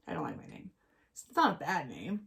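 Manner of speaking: normal talking voice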